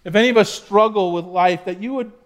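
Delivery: hushed, reverent prayer. Acted. Pastor leads a prayer.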